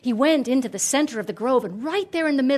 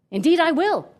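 higher, louder, hearty promise; eager agreement; 7 semitones higher, 6 dB louder than usual. A narrator's reading. Hero eagerly agrees to the request.

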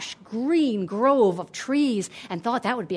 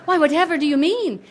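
higher, louder puzzled exclamation; puzzled question; 6 semitones higher, 6 dB louder than usual. A narrator's reading. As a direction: ask in open bewilderment. Hero questions the talking dog.

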